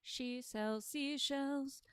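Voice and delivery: very slow, rising to high; exaggerated slow. Shown as a demonstration. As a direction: stretch each word out.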